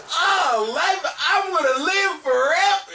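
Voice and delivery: high, loud, slow dreamy proclamation; naive youth parody. Retold or imitated. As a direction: gush like a starry-eyed kid who thinks he's immortal. Mocking naive young man.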